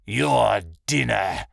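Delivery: very slow, menacing bear voice; drawn-out bear character voice; gloating bear. A narrator's reading. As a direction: stretch the threat out like a beast. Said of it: "the bear laughed".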